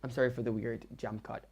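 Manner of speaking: normal talking voice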